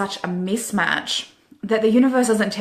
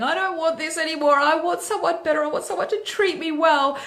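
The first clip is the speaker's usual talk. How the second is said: high whiny mock-crying voice, much higher; a hammed-up teary wail; mock sobbing; 10 semitones higher than usual. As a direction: imitate someone sobbing into ice cream. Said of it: "crying into that and going".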